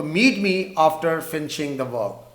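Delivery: very slow, word by word; slow dictation. Shown as a demonstration. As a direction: dictate slowly for the class. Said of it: "تو یہاں پر ہم نے after کا use کیا".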